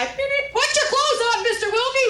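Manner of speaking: very high, loud mock shout; honk, then holler like a driver passing; high-pitched imitated catcall; heckling passers-by.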